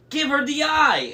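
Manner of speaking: high, loud urgent order; screaming. A narrator's reading. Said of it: "Annabeth screamed".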